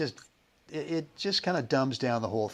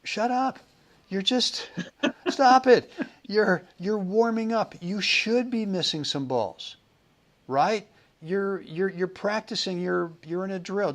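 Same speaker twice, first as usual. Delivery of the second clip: mock scolding in a higher voice; exasperated coach; 7 semitones higher than usual. Coach telling an apologizing player to stop, mock exasperated. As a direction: play the impatient coach cutting off the apologies. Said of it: "Mister Stowe would go".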